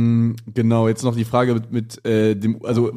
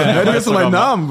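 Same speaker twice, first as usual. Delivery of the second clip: louder, higher, laughing disbelief; baffled retort; 6 semitones higher, 5 dB louder than usual. Retold or imitated. Answering the man who knew his name, baffled. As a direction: push back in amused confusion.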